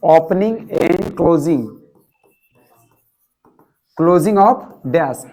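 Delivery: each word spelled out very slowly for the class; slow dictation. Shown as a demonstration. Teacher dictating the English phrase to the class, very slowly. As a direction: drag every word so students can repeat. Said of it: "तो क्या बोले?".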